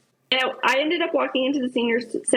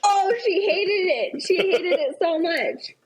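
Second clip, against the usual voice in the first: drawn-out, higher-pitched "hated it"; emphatic confirmation; 6 semitones higher, slower than usual. Real, not acted. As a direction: stretch HATED slowly with real exasperation. Confirms how much mom hated it.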